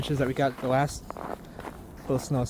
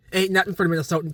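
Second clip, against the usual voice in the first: higher, quicker, fired-up boast; animated boast; 6 semitones higher, faster than usual. Real, not acted. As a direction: boast about the cold, pitched up and hurried. Repeats the boast more emphatically.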